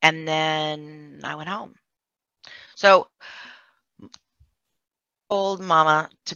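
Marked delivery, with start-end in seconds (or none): none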